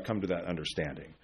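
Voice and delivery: ordinary talk, nothing marked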